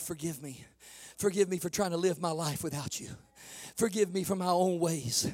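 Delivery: soft, low, earnest praying voice; hushed altar prayer. Acted. Preacher modeling a repentant prayer for the congregation, hushed and earnest. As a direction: pray it quietly as at the altar.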